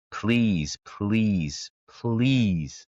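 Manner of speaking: each "please" drawn out and separate; emphatic plea. Real, not acted. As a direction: implore listeners, stretching every "please". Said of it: "I cannot stress enough".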